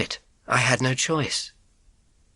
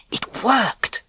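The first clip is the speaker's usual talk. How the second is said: delighted; 10 semitones higher than usual. A narrator's reading. Triumph, cat fooled.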